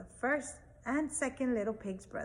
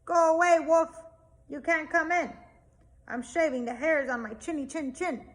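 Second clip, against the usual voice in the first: loud, higher yell from inside; yelling back; 4 semitones higher, 7 dB louder than usual. Acted. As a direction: shout the wolf away. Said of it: "He yelled back".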